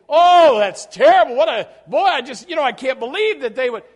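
loud, high appalled exclamation; shocked listener. Retold or imitated. Preacher voicing a churchgoer shocked by martyrs' suffering. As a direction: gasp at the horror. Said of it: "And you say".

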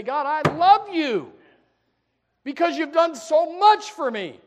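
earnest thanks lifted up to God; heartfelt prayer. Acted. Preacher voices a grateful prayer.

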